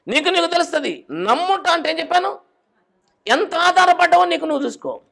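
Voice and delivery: high, loud passionate preaching; preaching fervently. Acted. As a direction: drive the point home to the congregation. Preaching at full force. (ఉత్సాహంగా గట్టిగా బోధించడం)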